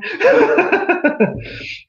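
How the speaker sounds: a high burst of laughter at herself. Real, not acted. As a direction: laugh at your own switch. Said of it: "You saw how I switched right there?".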